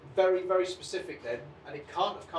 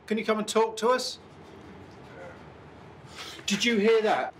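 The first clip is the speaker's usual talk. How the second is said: calling out; 5 semitones higher than usual. Real, not acted. Investigator asks spirit to come and talk, calling out. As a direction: project it to someone unseen.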